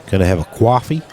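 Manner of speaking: coffee with a W, "kwoffee", rising; mock American accent. Shown as a demonstration. Commentator demonstrating to American viewers, mock American accent. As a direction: ask for coffee as a pretend American. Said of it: "say it with a W to sound like an American".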